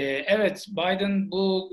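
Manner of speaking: ordinary talk, nothing marked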